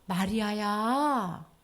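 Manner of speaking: very slow, drawn-out gentle call; tender calling. Acted. Risen Jesus calling Mary, slow tender voice. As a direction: stretch the name out gently. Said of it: "예수님이 나타나서".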